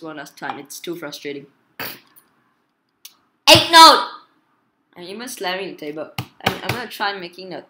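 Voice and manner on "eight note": high, very loud shout; yelled game name. Real, not acted. Player on one last try at the game, yelling. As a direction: shout it sharply at full voice.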